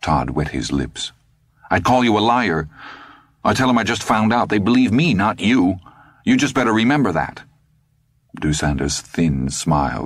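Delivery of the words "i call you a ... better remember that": put-on higher, fast boyish threat; defiant teen bluster. A narrator's reading. Todd threatens Dusander with fast bluster. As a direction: push back hard to sound tough.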